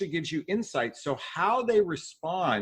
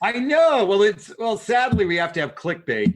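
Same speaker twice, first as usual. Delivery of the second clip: loud, higher laughing agreement; sheepishly owning the clickbait; animated; 6 semitones higher, 7 dB louder than usual. Real, not acted. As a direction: burst in, amused and loud.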